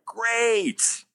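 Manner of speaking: high-pitched sarcastic praise; mock congratulations. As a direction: cheer it with exaggerated, false delight. Mocking an actor's shallow achievement.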